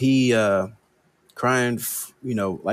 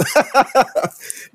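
high laugh; 3 dB louder than usual. Real, not acted. Laugh after the quote.

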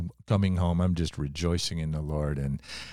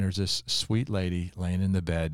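ordinary delivery

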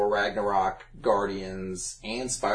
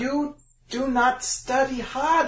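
slow, high mock Chinese accent; 13 semitones higher, much slower than usual. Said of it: "just do a Chinese accent".

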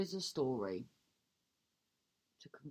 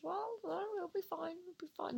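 squeaky, much higher and softer; high pitched; 13 semitones higher, 6 dB quieter, faster than usual. Real, not acted. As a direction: reassure yourself in a tiny high voice. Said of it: "Why have you gone high pitched?".

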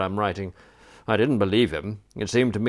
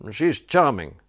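ordinary delivery, nothing marked

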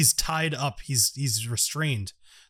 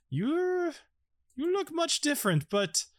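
high-pitched, hesitant character voice; puzzled character; 12 semitones higher, slower than usual. Acted. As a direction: puzzle over a changed friend in a high voice. Game master voices character recognizing changed friend.

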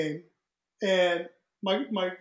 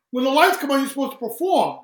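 mock scolding; 6 semitones higher, 6 dB louder than usual. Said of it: "I go".

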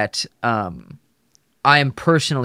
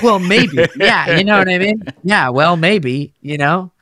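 higher and louder, joking; animated joking; 6 semitones higher, 6 dB louder than usual. Real, not acted. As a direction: play it up, half laughing. Joking about being fired.

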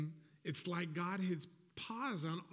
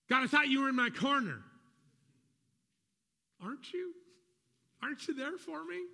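pleading believer; 5 semitones higher than usual. Retold or imitated. Believer crying out to God during a pause, anxious and pleading. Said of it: "it feels like".

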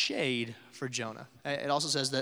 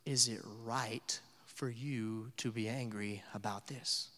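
soft question from God; 4 semitones lower, 9 dB quieter, slower than usual. Retold or imitated. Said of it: "God responds to Jonah with a question".

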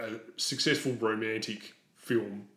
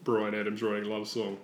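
ordinary talk

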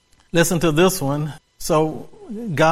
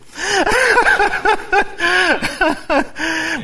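thrilled laugh. Real, not acted. Preacher laughing, thrilled by the meaning of Absalom. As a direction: laugh with goosebumps. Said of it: "I'm getting chill bumps here".

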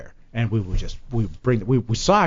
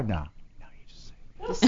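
quiet no; 5 semitones lower, 14 dB quieter than usual. Retold or imitated. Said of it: "they just made me sing".

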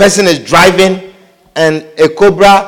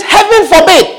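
high-pitched, appalled protest; Peter's reprimand; 9 semitones higher than usual. Retold or imitated. Peter reprimanding Jesus for such talk, high-pitched protest. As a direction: protest it high, like a scandalised friend. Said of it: "began to reprimand him".

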